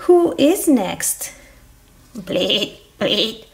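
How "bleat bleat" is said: low, short imitated bleats; goat bleat. Goat joining the line for the train, bleating. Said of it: "I am a goat".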